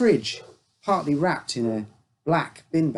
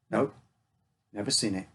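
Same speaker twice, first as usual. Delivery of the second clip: curt; 3 semitones lower, 5 dB quieter than usual. A narrator's reading. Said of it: "His voice was curt".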